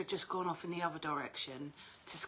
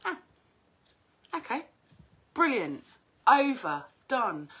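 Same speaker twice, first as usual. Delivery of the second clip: brisk relief; 6 semitones higher, 8 dB louder than usual. Retold or imitated. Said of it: "So I was like".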